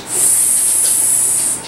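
long, soft hissed sss; drawn-out quiet snake sound. Shown as a demonstration. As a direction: imitate a snake hissing. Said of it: "The snake goes".

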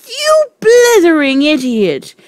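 high, loud furious shout. Acted. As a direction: scream the insult. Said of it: "shouted the tr".

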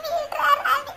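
weeping lament, fading away; sobbing. Acted. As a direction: sob out the grievance to a friend. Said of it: "மூசு மூசுன்னு அழுகுறீங்க".

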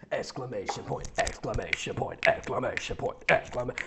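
low, soft monotone chant; deadpan recital. Pretend. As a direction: drone it like a machine. Reads punctuation aloud as running joke.

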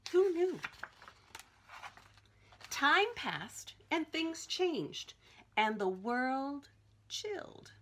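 put-on reading to children. Reading picture book aloud.

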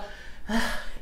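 soft, breath-only sigh; weary sigh. Real, not acted. Vlogger facing the hassle of switching emails, weary sigh. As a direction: let out a real sigh at the hassle.

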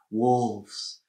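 ordinary delivery

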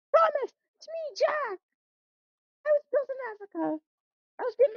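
a light high voice for the little cousin; high timid Jack. Acted. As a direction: squeak out the name hopefully. Jack introduces himself.

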